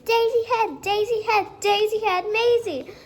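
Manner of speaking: high chanted taunt; kids shouting. A narrator's reading. Children taunting Maisie in class, a high chanting shout. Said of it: "started shouting like crazy".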